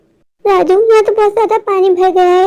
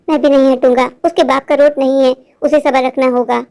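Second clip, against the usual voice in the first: put-on stubborn kid, lower than usual; defiant boy; 7 semitones lower than usual. Boy refusing to move for the driver, lower stubborn voice.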